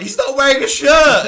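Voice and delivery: high, loud exasperated cry; mock exasperated shout. Host imitating Renee's exasperated shout. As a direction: yell it like she can't believe it. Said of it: "She's like".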